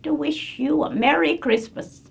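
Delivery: put-on high, quavering old lady voice; sweet little old lady wishing well; genteel old lady. Shown as a demonstration. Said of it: "the little old lady who's very genteel".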